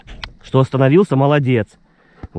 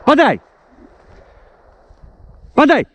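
shouted command; 4 dB louder than usual. Real, not acted. Dog training, calling commands.

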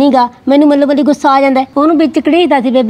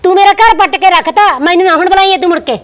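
raised-pitch, reproachful tirade; hurt angry outburst; 3 semitones higher than usual. Acted. Sister disowns the brother who cost her home, angry reproach. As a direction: push him away with pained anger.

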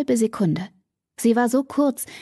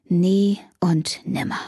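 slow whispered oath; quiet vow; slower than usual. A narrator's reading. Damon slowly whispering a vow never to allow this again. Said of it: "schwor Damon leise".

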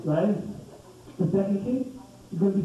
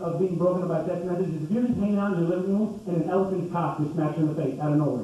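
ordinary delivery

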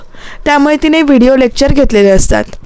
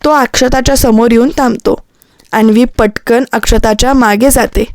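usual speaking voice

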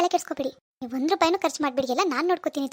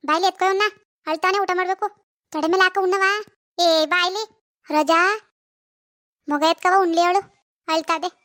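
higher and louder, calling across the house; 4 semitones higher, 5 dB louder than usual. Acted. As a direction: shout for someone to take the baby. (ಮಗು ಎತ್ಕೊಳ್ಳೋಕೆ ಜೋರಾಗಿ ಕೂಗಿ ಕರೆಯುವುದು)

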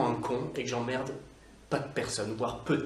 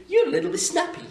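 high, louder teasing reproach; mock scolding; 5 dB louder than usual. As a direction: tease the rude writer. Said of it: "cette personne qui m'a écrit". (reproche moqueur, aigu et fort)

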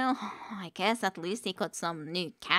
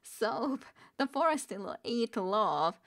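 higher, odd mock-mystic voice; strange voice; 4 semitones higher than usual. Shown as a demonstration. Rereading the odd line to viewers, in a strange put-on voice. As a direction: say it like a weird hidden creature.

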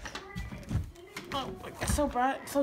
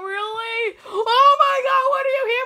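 mock wail; 8 semitones higher, 9 dB louder than usual. Losing game, mock crying outburst.

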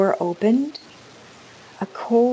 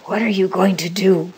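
usual speaking voice

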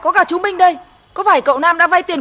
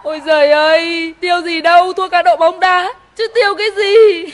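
crying lament. Acted. Mother sobs to her daughter about the lost school fees, crying lament. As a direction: cry out your grief to your daughter.

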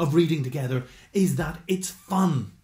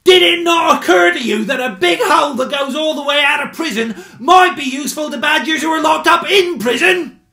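much higher, louder shouting badger; exasperated outburst; 14 semitones higher, 13 dB louder than usual. A narrator's reading. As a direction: let it all burst out in a furious yell. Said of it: "let it all out at once".

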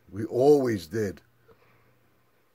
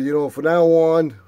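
1 raised voice; 5 semitones higher, 8 dB louder than usual. Retold or imitated. Confronting brother, raised voice.

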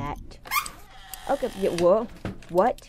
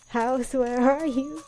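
slow, louder searching call; raised, lengthened mock search call; 4 semitones higher, 5 dB louder, slower than usual. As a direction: call out for something lost, drawing it out. Calling out to lost house.

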